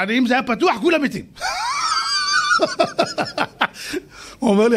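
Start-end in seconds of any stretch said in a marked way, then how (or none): 1.3-4.0 preacher laughing at his own story; big high laugh. Real, not acted.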